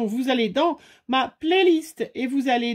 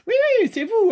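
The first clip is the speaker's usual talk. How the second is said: teasing exclamation; 11 semitones higher, 4 dB louder, faster than usual. Real, not acted. Teasing viewers who refused spiders.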